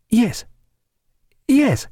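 high, halting assent; hesitant. A narrator's reading. Woman asked if she is alone, high hesitant answer. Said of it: "she answered hesitantly".

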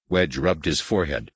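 plain reading voice

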